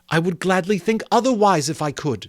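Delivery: put-on Belle, earnest young woman. Belle confronting Scrooge about his love of gain, earnest.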